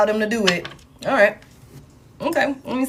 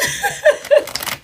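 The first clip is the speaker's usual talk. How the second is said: high laugh. Real, not acted. Laughs after punchline.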